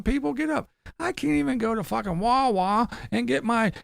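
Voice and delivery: high whiny mock of Tim; a mocking high-pitched impression of a complaining van dweller. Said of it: "Or is it".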